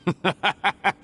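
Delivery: a short derisive laugh at the officers; mocking laugh. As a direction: laugh them off with contempt. Villain mocks officers.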